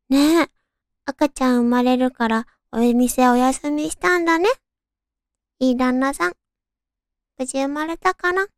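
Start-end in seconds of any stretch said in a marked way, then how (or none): none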